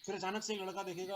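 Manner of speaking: ordinary talk, nothing marked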